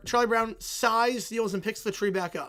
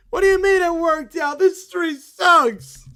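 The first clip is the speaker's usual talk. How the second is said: loud, higher, drawn-out protest; indignant shout; 6 semitones higher, 10 dB louder, slower than usual. Acted. Kid objects to the narrator's ending, loud indignant yell.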